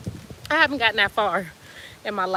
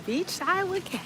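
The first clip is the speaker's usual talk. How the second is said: quiet echo; 8 semitones higher, 6 dB quieter than usual. Acted. Softer echo of show title.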